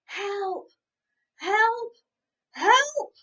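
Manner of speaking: building high loud shouts; shouting help. Acted. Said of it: "cried at the top of their voices".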